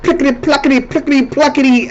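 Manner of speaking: high-pitched chattering imitation of an Indian accent; mock Indian accent. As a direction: mimic an Indian accent in nonsense syllables. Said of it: "accent they got".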